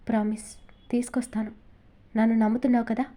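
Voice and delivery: a hushed, breathy whisper close to her ear. Acted. Krish reassures crying Mohana, whispering his promise.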